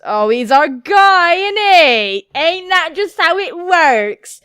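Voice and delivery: high, loud, stretched-out words; big over-the-top reaction; loud exclaiming. Real, not acted. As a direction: belt it out with drawn-out words. Loud reaction to game character.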